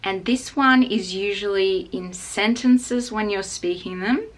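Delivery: ordinary delivery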